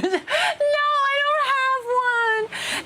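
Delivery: very high, drawn-out squeak; squeaky high. Real, not acted. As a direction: squeak it out slowly.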